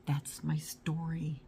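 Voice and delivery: slow hushed whisper. Real, not acted. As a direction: breathe it out slowly. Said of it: "whispered aside".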